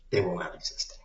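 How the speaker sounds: whispered, threatening and low; menacing whisper. Acted. Whispering to viewers a grim nuclear threat, menacing whisper. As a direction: hiss it like a dark secret. Ominous threat about Russians.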